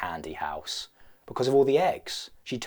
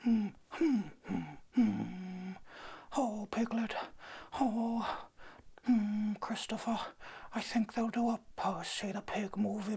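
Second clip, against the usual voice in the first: high, soft, dreamy bear murmur; musing like a sleepy honey bear; high breathy Pooh Bear musing to himself; Winnie the Pooh voice; 9 semitones higher, 11 dB quieter than usual. Retold or imitated. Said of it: "I've lost it, I'm losing it".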